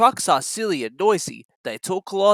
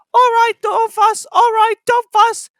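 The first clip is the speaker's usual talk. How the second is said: very high, slow, loud grumbling truck voice; 8 dB louder than usual. A narrator's reading. Trucks grumbling at Thomas, high and whiny. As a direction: whine it grudgingly. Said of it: "grumbled the trucks".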